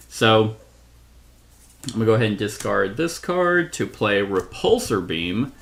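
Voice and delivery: ordinary talk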